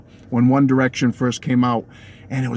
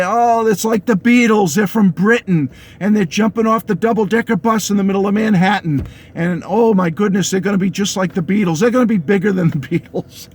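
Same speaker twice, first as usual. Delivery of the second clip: higher-pitched imitation of hyped-up anchors; mock gushing; 5 semitones higher than usual. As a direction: gush with mock amazement, pitched up. Mimics gushing anchors on boy band.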